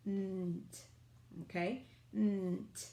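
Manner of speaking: Nt isolated, crisp end blend; blend sound. Shown as a demonstration. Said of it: "consonant blend at the end".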